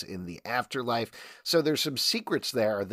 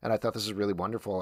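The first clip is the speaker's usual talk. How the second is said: normal talking voice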